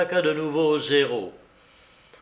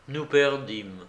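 ordinary delivery

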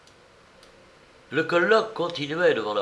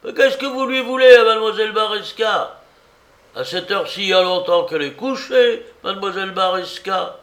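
high, loud scolding from a window; exclaiming; 9 semitones higher, 8 dB louder, faster than usual. A narrator's reading. Neighbour at window to the men knocking, loud exclaiming. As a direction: shout down at noisy callers in the street. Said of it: "une voix s'exclama".

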